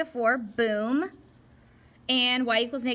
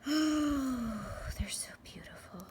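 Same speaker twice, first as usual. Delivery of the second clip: breathy, slow, admiring whisper; dreamy whisper; 4 semitones higher, 3 dB quieter, much slower than usual. Real, not acted. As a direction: sigh it out softly in awe of the lines. Admiring her own graph.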